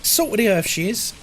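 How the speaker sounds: a hint of a doting nan; British nan impression. Streamer repeats the nan bit for chat, lightly voiced.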